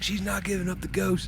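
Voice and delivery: high, soft retold remark; quoting dad. Son retelling dad's words on the boat, high and soft. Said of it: "My dad kept saying that. He was like".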